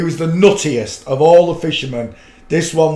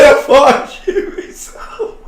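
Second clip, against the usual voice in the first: laughing quote; 10 semitones higher, slower than usual. Retold or imitated. Quoting brother while laughing.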